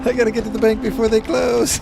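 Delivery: high, loud panicked shouting; frantic yell. Pretend. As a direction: yell like you're late for the bank. Player voices frantic dash through crowd.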